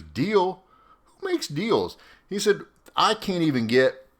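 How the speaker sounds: high, rising rant of an officer losing patience; exasperated commander. Retold or imitated. Major ranting that a junior soldier out-dealt him, high exasperated rant.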